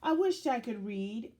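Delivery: ordinary delivery, nothing marked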